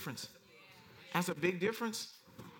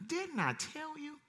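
slow chiding; 8 semitones higher, 5 dB quieter, slower than usual. Acted. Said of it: "Jesus responded".

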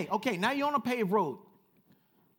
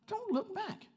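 much higher, falling command; high urgent repeat; 13 semitones higher, slower than usual. Acted. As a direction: leap up and drop it like an alarm.

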